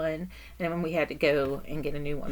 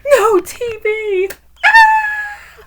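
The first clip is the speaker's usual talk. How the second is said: much higher, much louder hammed-up wail; mock horror; 13 semitones higher, 16 dB louder, slower than usual. Daughter mocking her mother's TV panic with hammed-up loud horror. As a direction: overplay the tragedy to tease her. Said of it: "I really did that".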